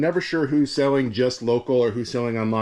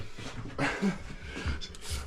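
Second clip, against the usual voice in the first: breathy laugh; 6 semitones higher, 11 dB quieter than usual. Real, not acted. Laughs at fussy buyer note.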